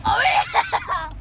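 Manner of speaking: loud, high whooping shout; playful yell. Real, not acted. Goofing off out the car window, playful yell.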